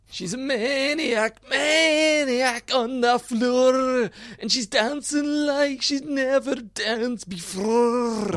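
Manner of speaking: high, slow, hammed-up Scottish accent, half sung. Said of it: "You want me to do, uh, the Maniac?".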